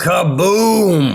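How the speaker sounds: booming slow announcer shout; fighting game announcer. Acted. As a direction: boom it out like an arena announcer. Announcer guest's entrance catchphrase.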